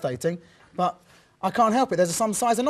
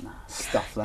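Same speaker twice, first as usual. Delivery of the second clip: whispered grumble; 10 dB quieter than usual. Pretend. Imagined thought of sleepy presenter.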